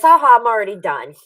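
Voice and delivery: loud, teasing gotcha; gleeful dentist. Retold or imitated. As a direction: crow that it is already done. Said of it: "and then he goes".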